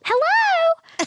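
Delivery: little sister answering the phone, high and sweet; high little-girl hello. Retold or imitated. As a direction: mimic the kid sister picking up.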